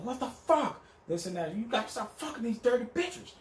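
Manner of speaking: high, outraged put-on woman's voice; angry girlfriend. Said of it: "she was".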